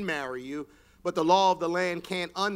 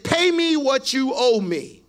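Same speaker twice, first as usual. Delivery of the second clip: louder, higher demand from a man gripping a throat; threatening demand; 4 semitones higher, 11 dB louder, slower than usual. Acted. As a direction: squeeze the debt out of him. Servant grabs his debtor's throat.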